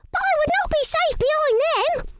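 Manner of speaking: high squeaky little mouse voice. Acted. Missus Mouse talks to herself about the food, squeaky high. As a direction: pipe up like a tiny mouse.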